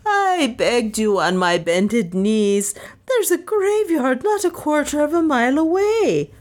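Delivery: high-pitched wailing protest; vociferating. A narrator's reading. Maid reproaching mistress about the graveyard, wailing. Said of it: "she vociferated".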